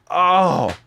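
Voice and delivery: high, loud acted relief; huge relief. Friends learning they were spiked, high loud relief.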